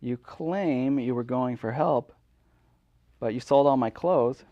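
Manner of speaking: normal talking voice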